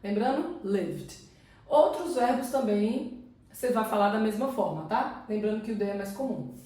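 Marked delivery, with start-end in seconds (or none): 0.6-1.2 "lived" in one syllable ending in D; silent E. Shown as a demonstration. Teacher reminding learners of the rule, "lived" with silent E. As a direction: go straight from V to D in "lived". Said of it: "eu não vou pronunciar".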